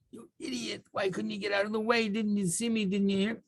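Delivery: high-pitched, held-back imitation of screaming; mock screaming. Boat owner berates the other boat after a collision, mock screaming. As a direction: yell in a small, high voice without real volume. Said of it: "started screaming".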